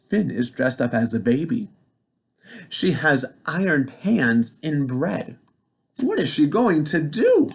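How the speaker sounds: ordinary delivery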